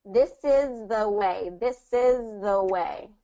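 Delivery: slow and linked, no break at is the; slow linked model. Shown as a demonstration. Tutor showing smooth linking to the learner, slow. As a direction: glide through This is the way.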